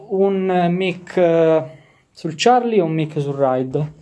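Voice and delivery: ordinary talk, nothing marked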